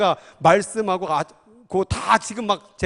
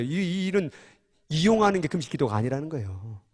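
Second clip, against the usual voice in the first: ordinary delivery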